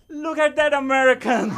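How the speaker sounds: loud, high mocking jab; mocking shout. UK coworkers mock him as an American, loud mocking shout. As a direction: yell it like teasing coworkers pointing you out. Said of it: "all my U K coworkers, like".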